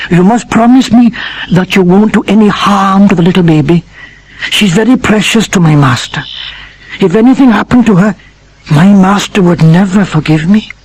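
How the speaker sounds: dog Sultan. Acted. Sultan's voice, pleading.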